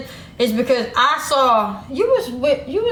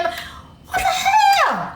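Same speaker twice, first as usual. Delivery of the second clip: shocked outburst; slower than usual. Real, not acted.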